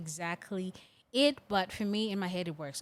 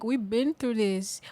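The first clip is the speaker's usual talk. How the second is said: usual speaking voice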